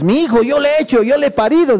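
high-pitched, insistent mock protest; possessive protest. Mother claiming the son she bore, possessive mock protest. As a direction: insist on ownership, acted.

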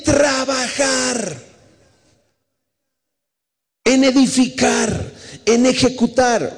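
high, drawn-out exhortation; preaching declamation. Real, not acted. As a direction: stretch each command out, high-pitched, with pauses between. Preacher declaims the scripture's command. (declamación de predicador, aguda y alargada)

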